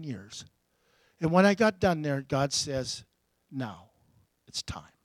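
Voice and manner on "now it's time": soft, whispered divine word; hushed voice of God. Retold or imitated. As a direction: breathe it like a secret. God's words quoted.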